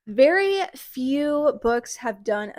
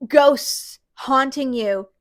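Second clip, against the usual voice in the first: heated; 8 dB louder than usual. Real, not acted. Still heated after rant.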